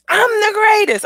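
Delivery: high, loud self-declaration; proud affirmation. Shown as a demonstration. Closing the affirmation lesson, proudly proclaiming it. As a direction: proclaim it with full conviction. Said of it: "when you say words like that".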